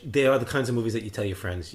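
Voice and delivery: usual speaking voice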